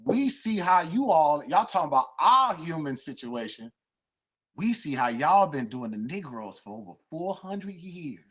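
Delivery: loud quote. Retold or imitated. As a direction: project it like a stern delegate. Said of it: "He said".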